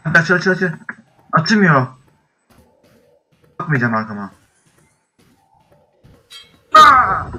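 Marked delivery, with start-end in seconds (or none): 0.0-1.9 panicked, high and urgent; frantic escape, shouting at door; being chased. Real, not acted. Fleeing, door won't open. 6.7-7.2 startled scream. Real, not acted. Sudden scare.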